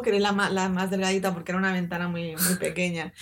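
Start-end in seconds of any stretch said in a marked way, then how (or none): none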